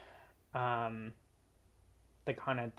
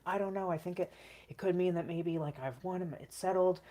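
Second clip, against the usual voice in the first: excited; 7 semitones higher, 4 dB louder than usual. Retold or imitated. Unsure what the money means.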